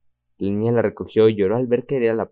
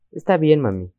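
put-on sobbing child; 7 semitones higher, 4 dB louder than usual. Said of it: "La niña sollozó".

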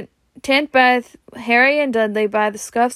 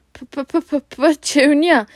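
gulping stammer; 5 semitones higher, 3 dB louder, slower than usual. Acted. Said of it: "he gulped".

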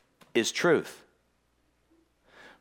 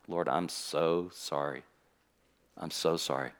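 soft, slow, low tearful apology; whisper-quiet, near tears, begging forgiveness; tearful repentance; 10 semitones lower, 8 dB quieter, slower than usual. Retold or imitated. Pastor retelling his tearful prayer to God, soft and slow. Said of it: "I started to cry again".

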